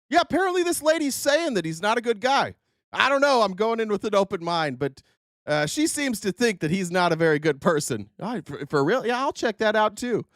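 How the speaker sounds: high, naive voice, wide-eyed and gullible; mock clueless attendee. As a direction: play a clueless fan hearing old news. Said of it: "the person that's like".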